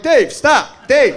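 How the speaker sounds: high, pleading coworker; awkward coworker. Retold or imitated. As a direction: plead with Dave to stop. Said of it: "it's your coworker, like".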